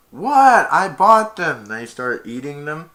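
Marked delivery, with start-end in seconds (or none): 0.1-1.7 loud high outraged squeak; hammed-up offended protest; mock indignant. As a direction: act shocked that anyone would doubt you. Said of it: "And he went".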